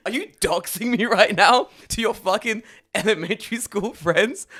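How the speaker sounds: loud, high, incredulous rant; mock outrage. Neighbour challenging kid who exposed his address, mock outrage. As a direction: demand it in comic indignation.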